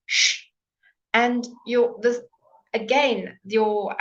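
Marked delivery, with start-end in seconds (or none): 0.0-0.4 long hissed shh through the front teeth; teacher's model shh; demo sh sound. Shown as a demonstration. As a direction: push air out in a steady shh. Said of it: "It must go out the front".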